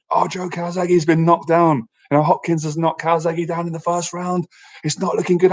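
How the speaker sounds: radio commentator. Retold or imitated. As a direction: lightly play the doom-laden radio man. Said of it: "they're going".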